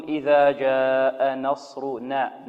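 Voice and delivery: نصرنا with a heavy sad and a light nun; slow correct recitation. Shown as a demonstration. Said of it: "إذن هنا نقول". (تلاوة بطيئة لنصرنا بنون مرققة)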